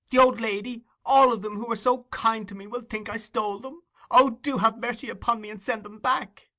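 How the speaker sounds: put-on high, pleading boy's voice; passionate grief. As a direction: beg as if your heart is breaking. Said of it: "uttered with all the energy of passionate grief".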